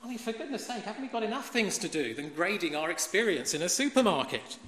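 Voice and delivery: ordinary talk, nothing marked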